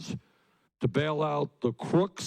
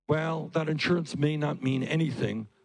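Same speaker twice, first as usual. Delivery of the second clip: usual speaking voice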